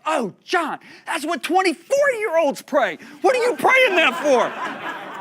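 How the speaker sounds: very high, loud, comic shout; hammed-up incredulous wail, stretched out slowly; exclaiming in disbelief. Pastor friend aghast at John's prayer, exclaiming in disbelief. Friend's shocked reply, acted out.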